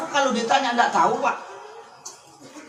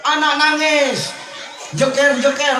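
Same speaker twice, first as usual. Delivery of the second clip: louder, slowed cry of alarm; loud exclaim; 4 semitones higher, 4 dB louder than usual. Retold or imitated. Preacher to audience, loud slow exclaim. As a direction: belt it out slowly. Retelling the baby's crying.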